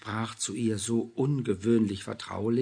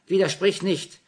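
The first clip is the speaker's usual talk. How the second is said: higher, louder order; bossy; 9 semitones higher, 10 dB louder than usual. A narrator's reading. Julia silencing Anna, bossy and loud. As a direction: shut her down. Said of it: "Julia commanding".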